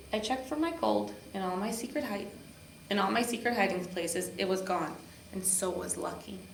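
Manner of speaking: ordinary delivery, nothing marked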